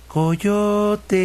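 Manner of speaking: high, far-off calling voice; calling from above. A narrator's reading. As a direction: call him from up in the sky. Voice calling from the sky. (voz lejana que llama desde arriba)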